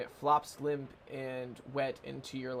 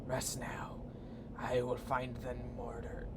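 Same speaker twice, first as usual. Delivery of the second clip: ghostly creature; 3 semitones lower, much slower than usual. Acted. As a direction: whisper it like a solemn spectre. Game creature's archaic dialogue.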